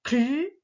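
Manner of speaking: CLU with K running into a long L; slow blended CLU; blended sounds. Shown as a demonstration. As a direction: stretch the L in CLU as you blend. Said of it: "les deux ensemble, ça fait".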